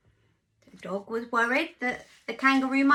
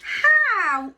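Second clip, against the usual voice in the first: very slow, loud, drawn-out dismay; hammed-up farmyard gasp of dismay; dismayed chorus; 8 semitones higher, 8 dB louder, much slower than usual. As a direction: stretch it out in mock horror. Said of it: "said everyone".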